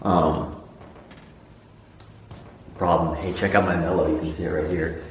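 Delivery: hushed late-night whisper. Real, not acted. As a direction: whisper close to the camera, Blair Witch style. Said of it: "at night I feel like I have to whisper".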